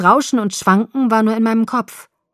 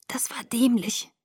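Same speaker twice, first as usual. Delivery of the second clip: soft, slow, higher weak voice; murmured; 4 semitones higher, 6 dB quieter than usual. A narrator's reading. Bella admitting her mistake to Jacob, feeble murmur. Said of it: "murmelte ich".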